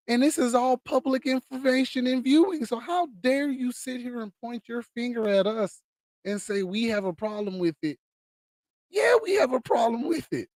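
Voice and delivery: building to a high yell; defiant shout. Real, not acted. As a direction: ramp up and shout the answer.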